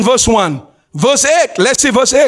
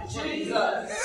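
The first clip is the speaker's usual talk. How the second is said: ordinary talk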